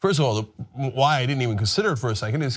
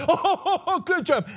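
high, giggling mock anchor; laughing mock praise; 13 semitones higher, much slower than usual. Host mocking the anchor's praise of the president, laughing high. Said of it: "And Maria's like".

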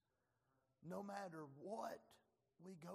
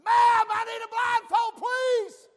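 frantic yell for help, much higher and louder, slow; panicked shout; 29 dB louder, slower than usual. Retold or imitated. Trapped in the MRI, begging the nurse for a blindfold, panicked shout. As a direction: holler out in panic from inside the machine.

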